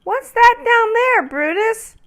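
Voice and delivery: very high, small, curious voice; little ornament voice. Acted. Ornament Noel asks the big tree, high and curious. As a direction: ask like a tiny wondering ornament. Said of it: "Noel asked the huge tree".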